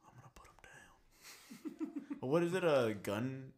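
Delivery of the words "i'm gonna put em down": breathy, slow mock threat; menacing whisper. Imagining a Popeyes stabber, slow menacing whisper. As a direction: whisper it like a movie killer.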